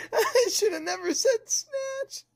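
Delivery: high, laughing through tears; embarrassed, cracking up. Real, not acted. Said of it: "I'm crying".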